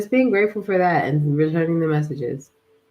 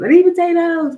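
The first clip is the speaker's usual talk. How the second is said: mock desperate cry; 10 semitones higher, 7 dB louder than usual. Craving quote repeated louder.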